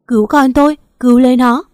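high, loud desperate pleading; begging them to save her baby; crying plea. Acted. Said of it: "vừa khóc vừa nói". (vừa khóc vừa van xin, cao và to)

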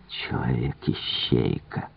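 very soft, slow and low; whispering. A narrator's reading. Poirot on Giraud the human bloodhound, whispering. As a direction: murmur it to oneself, drawn out. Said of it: "прошептал Пуаро".

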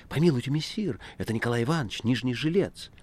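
startled, imploring appeal; alarmed plea. A narrator's reading. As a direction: plead for him in mild fright. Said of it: "испуганно вскрикнула Маргарита".

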